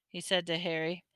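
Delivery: ordinary delivery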